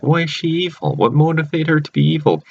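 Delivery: high-pitched, puzzled demand for a reason; baffled questioning. Retold or imitated. Viewer reacting to the sister turning evil, baffled. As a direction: act out bewilderment, voice jumping up.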